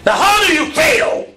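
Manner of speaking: high, loud preached question; preaching shout. Real, not acted. Preacher shouting the question to the congregation. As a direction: cry it out to the church. Preacher climbing to full force.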